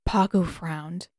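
usual speaking voice

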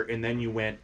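usual speaking voice

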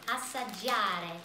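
Assaggiare said slowly, syllable by syllable, the Italian way; Italian pronunciation. Shown as a demonstration. Teaching the viewers the Italian word, slow and clear. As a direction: stretch out Assaggiare in careful Italian. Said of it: "That's how we say that".

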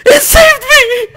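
very high, loud shriek dissolving into laughter; ecstatic screaming. Real, not acted. Player discovering the game saved them, ecstatic screaming. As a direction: scream with relief, nearly sobbing with laughter.

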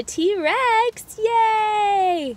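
high, loud delighted cheer; excited cheer. Real, not acted. Mom cheering child's presents.

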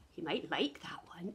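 fast soft whisper. Acted. Teacher whispers a guess to the children. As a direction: confide it to the kids. Hushed aside.

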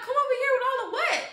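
high-pitched, indignant quote; mad comeback. Retold or imitated. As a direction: throw it back, incredulous and irritated. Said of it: "I was mad by that part".

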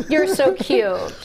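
slow cooing pet voice; dog talk voice. Shown as a demonstration. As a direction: croon it to a dog. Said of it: "That's my adult dog talk voice".

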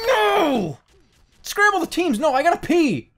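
high-pitched wail of "no"; desperate protest. Real, not acted. Gamer who has to pee as teams scramble, high desperate protest. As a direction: cry out high in urgent protest.

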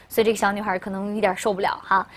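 usual speaking voice

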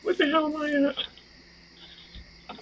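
very high, slow, drawn-out goofy voice; silly high voice. Pretend. Player goofing on his lost character, very high and slow. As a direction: stretch it out high like a lost cartoon.

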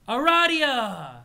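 loud, high, drawn-out name; calling out. Acted. Character hailing Aradia, long excited shout. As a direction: holler it with a long stretched vowel.

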